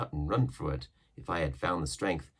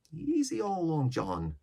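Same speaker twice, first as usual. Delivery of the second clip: sailor voice; 8 semitones higher than usual. A narrator's reading. Israel voiced.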